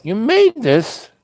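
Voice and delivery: high, slow incredulous question; disbelief. Retold or imitated. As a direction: drag it out in amazed disbelief. Disbelief he cooked it.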